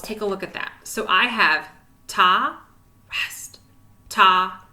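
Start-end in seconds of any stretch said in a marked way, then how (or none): 2.1-2.6 ta spoken clearly and louder. Shown as a demonstration. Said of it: "Can you say that with me?". 3.1-3.6 rest breathed softly, no voice; rest, barely whispered. Shown as a demonstration. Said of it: "So whisper those rests".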